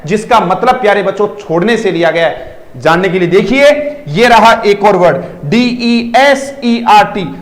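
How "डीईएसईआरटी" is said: slow spelling of डीईएसईआरटी for the class; spelling out a word very slowly. Shown as a demonstration. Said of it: "पहले तो इसका pronunciation जानिए".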